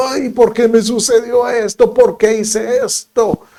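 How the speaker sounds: mock lament. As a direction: sigh out a self-pitying complaint. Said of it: "una emoción ... de disgusto".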